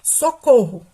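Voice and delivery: loud cry for help, demo; exclamation. Shown as a demonstration. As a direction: call it out as an exclamation. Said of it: "se eu falo assim".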